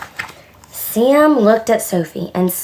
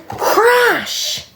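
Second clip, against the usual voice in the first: high, drawn-out crash effect; big storybook crash; dramatic crash. Acted. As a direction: burst out the word like an impact. Acts out the collision noise.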